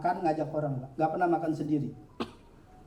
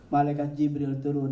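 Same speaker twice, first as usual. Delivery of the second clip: ordinary talk, nothing marked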